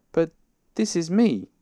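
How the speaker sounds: high, loud astonished protest; very surprised. A narrator's reading. Bear hearing he is elsewhere, very surprised. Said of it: "very much surprised".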